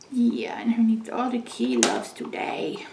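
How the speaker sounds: soft cooing whisper. Real, not acted. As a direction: whisper lovingly to the pet. Whispering to the cat.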